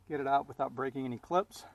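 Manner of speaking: usual speaking voice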